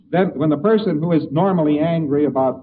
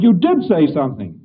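louder, insistent; an angry man insisting he was provoked; mock angry retort; 3 semitones higher, 7 dB louder than usual. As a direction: push back hard on him. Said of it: "And the fellow said".

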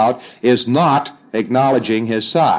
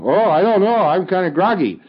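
higher, groggy voice, as if just out of his head; dazed pre-clear; 7 semitones higher than usual. Acted. Auditor acting out a groggy client answering his auditor.

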